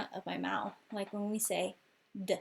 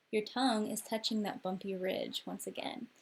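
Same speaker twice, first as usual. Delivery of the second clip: usual speaking voice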